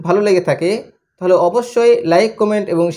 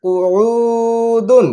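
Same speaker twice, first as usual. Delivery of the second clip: very slow, long-held blend; drawn-out joined word; 3 semitones higher, much slower than usual. Shown as a demonstration. Teacher showing pupils how syllables join, very slow. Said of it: "যুক্ত করে".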